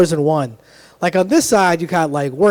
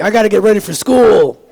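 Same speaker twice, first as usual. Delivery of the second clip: louder, menacing take on the same line; the gun-aisle kid saying it with a threat; armed tough kid; 3 semitones higher, 6 dB louder than usual. Acted. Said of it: "this side's like".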